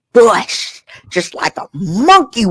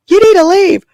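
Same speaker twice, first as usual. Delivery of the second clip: put-on much higher sassy girlfriend voice; sassy friend voice; 13 semitones higher, 4 dB louder than usual. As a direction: camp it up high and sassy.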